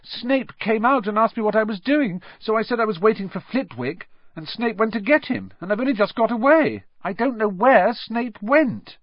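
high, distressed apology; upset girl wailing an apology. A narrator's reading. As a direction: cry out in dismay. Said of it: "she wailed".

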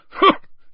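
one quick loud laugh; short booming laugh. A narrator's reading. Bagman laughing at his own joke, short and loud. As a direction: bark out a single hearty laugh. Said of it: "a short, booming laugh".

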